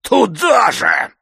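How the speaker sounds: high, loud, drawn-out angry shout; thundering. A narrator's reading. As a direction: bellow it scornfully to chase her away. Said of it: "загремел он".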